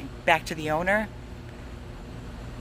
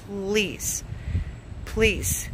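real begging; 3 dB quieter than usual. Real, not acted. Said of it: "and I am begging you".

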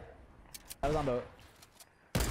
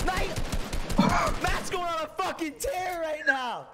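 excited hype; 7 semitones higher, 4 dB louder than usual. Real, not acted. Hyping a teammate.

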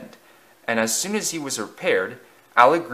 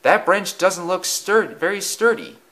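put-on worried boy; 8 semitones higher than usual. Church boy warns John in the tree, higher and anxious. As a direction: warn your friend up the tree, higher. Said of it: "said one".

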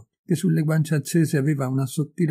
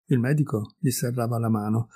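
usual speaking voice

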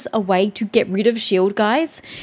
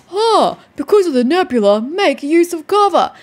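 high, loud sing-song parody of a know-it-all; sarcastic singsong quote of online guides; mocking guide voice; 6 semitones higher, 5 dB louder than usual. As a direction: mimic the smug guide advice. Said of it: "they were like".

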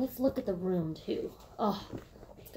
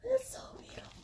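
much higher whispered awe; awed whisper; 7 dB quieter, much faster than usual. Real, not acted. Vlogger whispering in awe over the purse. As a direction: breathe it out, starstruck. Stares at the bag.

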